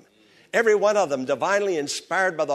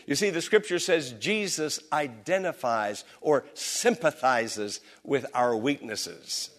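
ordinary talk, nothing marked

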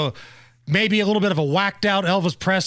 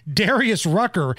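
ordinary talk

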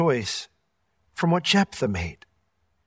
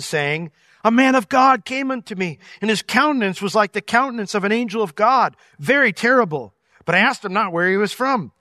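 measured, raised reading of the verse; scripture reading; 3 semitones higher, 3 dB louder than usual. Acted. Pastor reading verses to the church, raised formal reading. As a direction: read the passage out to the congregation.

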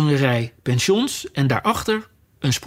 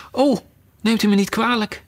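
quick, high apologetic cry; hasty apology; 7 semitones higher than usual. A narrator's reading. Alice hastily apologises to the frightened mouse. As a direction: blurt the apology out in a hurry. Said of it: "riep Alice haastig".

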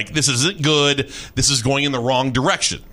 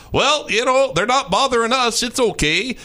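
Canadian; 7 semitones higher than usual. Retold or imitated. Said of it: "the Canadians are just like".

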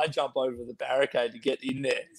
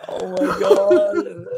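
laughing oh my God; 7 semitones higher, much slower than usual. Retold or imitated. Group reaction retold, laughing.

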